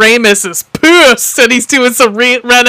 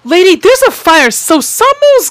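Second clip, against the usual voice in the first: mock outrage; 6 semitones higher, 4 dB louder than usual. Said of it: "I wrote, I go".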